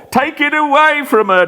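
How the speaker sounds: high, desperate, away stressed; panicked plea. Acted. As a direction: beg them to take it away. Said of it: "Next he cries".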